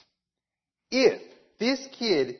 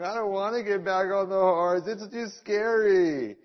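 put-on crying child voice; 3 semitones higher than usual. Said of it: "And I was crying".

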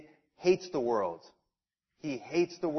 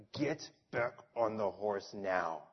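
stern aunt; 8 semitones lower, 10 dB quieter than usual. Retold or imitated. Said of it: "My aunt was like".

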